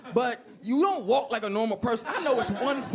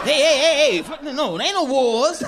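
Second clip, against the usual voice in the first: raised, jovial brush-off; loud distraction; 4 semitones higher, 8 dB louder than usual. Acted. President making people forget the bad news, loud cheerful denial. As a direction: wave the question away loudly and higher.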